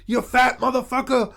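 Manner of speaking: high, sputtering imitation of an old man; angry old man.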